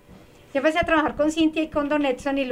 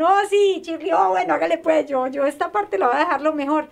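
eager coworkers; 2 semitones higher than usual. Retold or imitated. Coworkers accepting the cleaning challenge, eager. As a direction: play the coworkers jumping in keenly. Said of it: "las compañeras eran".

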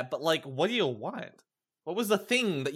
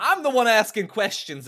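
loud, insistent reminder; assertive interrogator; 6 semitones higher, 7 dB louder than usual. Acted. Player reasserting control over Waluigi, assertive. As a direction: take back control of the questioning, louder.

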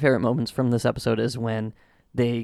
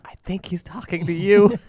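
quoting Homer; 7 semitones higher, 4 dB louder than usual. Retold or imitated. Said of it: "and he's like".

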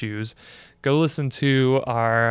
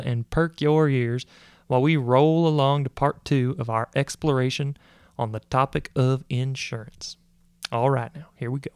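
hammed-up Texas rancher voice; Texan accent. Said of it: "sometimes I really wanna talk like a, like a Texan".

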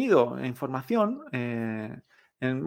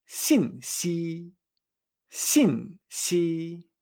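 "xinxi" falling first, then held long and even; falling then level tones; much slower than usual. Shown as a demonstration. Teacher modelling information, "xinxi" falling then level. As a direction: drop "xin", sustain "xi" on one note. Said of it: "son con la misma entonación".

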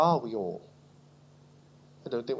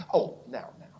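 mock complacent protest; 4 semitones lower, 9 dB quieter than usual. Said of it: "Oh, we would say".

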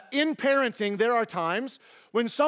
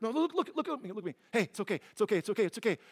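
soft, fading hush of reassurance; calm a crying child, getting quieter and quieter; soothing a panicked child; 5 dB quieter, much faster than usual. Retold or imitated. Parent to a panicked child, soothing and fading to a hush. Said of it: "And they're looking around like".

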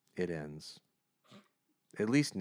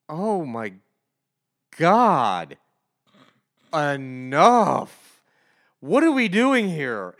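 loud, high, slow groan of disbelief; fed-up loud complaint at the comic; exasperated outburst; 4 semitones higher, 9 dB louder, much slower than usual. Real, not acted. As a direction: throw up your hands at the page.

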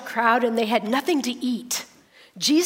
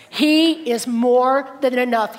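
louder, slow and emphatic; proclaiming; 5 dB louder, slower than usual. Acted. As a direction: declare it boldly to the room. Whispered then proclaimed refrain.

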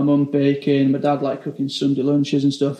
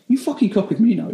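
lightly voiced demanding chef; 7 semitones higher than usual. Head chef orders stagiaire.

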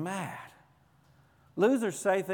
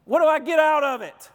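high, louder, pointed question; selfish inner voice; 8 semitones higher, 7 dB louder than usual. Retold or imitated. Preacher mocking the congregation's selfish thinking, raised and high. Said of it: "if you're thinking".

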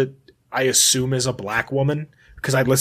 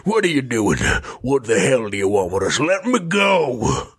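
cowboy voice; 2 semitones lower than usual. Acted. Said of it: "my cowboy voice".

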